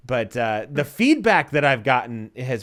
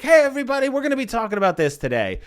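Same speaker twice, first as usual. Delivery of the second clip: higher, bright host energy, a bit fast; upbeat webinar host; 9 semitones higher, 4 dB louder, faster than usual. Retold or imitated. Greeting the webinar audience, upbeat presenter voice. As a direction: open the webinar with big energy. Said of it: "And I'm like".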